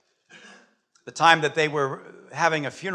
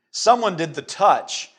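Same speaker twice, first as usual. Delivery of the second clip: slow, loud, raised declaration; emphatic insistence; 4 semitones higher, 7 dB louder, slower than usual. Acted. Jesus insisting to his disciples that he was touched, emphatic. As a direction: hit it hard and draw it out.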